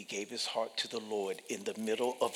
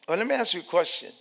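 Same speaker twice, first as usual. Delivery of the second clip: normal talking voice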